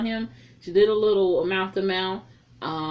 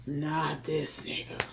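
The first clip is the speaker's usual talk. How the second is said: disgusted groan; 3 semitones lower, 5 dB quieter, much slower than usual. Retold or imitated. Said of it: "And I'm like".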